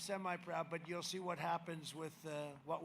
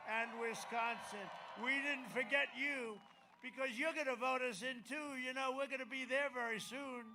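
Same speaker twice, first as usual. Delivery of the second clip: rally speech; 5 semitones higher, 5 dB louder than usual. Acted.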